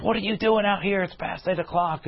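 mock yelling woman. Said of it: "She's, like, yelling at me".

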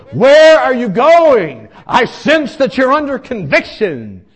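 loud, high mock-preacher challenge; confrontational shout. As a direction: call him out at full volume. Acting out Tony confronting him.

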